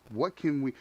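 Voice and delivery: ordinary delivery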